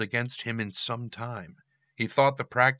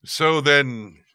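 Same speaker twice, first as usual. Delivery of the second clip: booming demon lord; 6 semitones higher, 10 dB louder than usual. Acted. Demon restarts his address.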